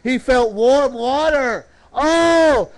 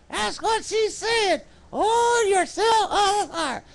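high, slow puppet sidekick; 9 semitones higher, 4 dB quieter than usual. Pretend. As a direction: answer in a high-pitched puppet voice, drawn out. Puppet Rowdy answers the preacher.

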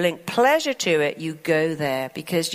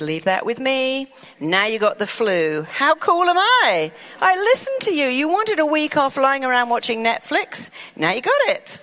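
gloating mind, much higher and louder; gleeful mind; 6 semitones higher, 3 dB louder than usual. Acted. The mind boasting to its owner about the flu, giddy. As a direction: crow proudly at the granted wish.

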